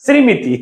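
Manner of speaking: শ্রীমিতি said "srimiti", with the ম sounded; sri-mi-ti for শ্রীমিতি, as a misreading; mistaken pronunciation. Shown as a demonstration. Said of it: "এরকম করে বলতেও তো পারো".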